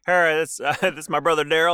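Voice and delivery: normal talking voice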